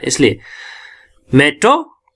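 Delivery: normal talking voice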